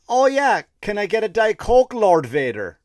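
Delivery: mock cheery order. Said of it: "And I went".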